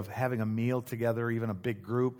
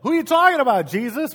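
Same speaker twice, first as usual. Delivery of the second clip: much louder, far higher-pitched shout; loud, brash Peter; 11 semitones higher, 10 dB louder than usual. Acted. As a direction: blurt it out in front of everybody. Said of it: "Peter's a pretty loud, outspoken, brash".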